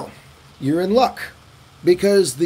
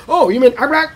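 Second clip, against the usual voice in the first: high, eager mistaken guess; a hint of a puzzled listener guessing; confused local; 6 semitones higher, 4 dB louder than usual. Local mishearing his name, high and eager. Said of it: "I'm like".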